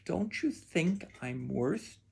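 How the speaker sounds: soft, slow, hesitant-paced question; quietly. A narrator's reading. Daughter asking her father about her worth, quietly. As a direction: drop soft and take it slowly. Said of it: "Taya quietly said".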